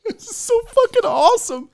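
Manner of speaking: very high, thrilled exclamation; overwhelmed delight. Real, not acted. Unboxer overwhelmed by friends' gift, delighted squeal. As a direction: squeal it out, overjoyed.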